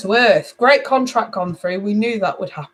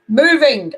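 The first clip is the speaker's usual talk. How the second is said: MOVING said louder and higher; emphatic repetition; 4 semitones higher, 5 dB louder than usual. Real, not acted. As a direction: stress "Moving" firmly, falling at the end. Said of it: "Emphasis on moving".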